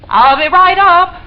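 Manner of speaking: loud, high call upward; bright loud call to him upstairs. Acted. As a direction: project it up the stairs. Calling up to Mr Jennings.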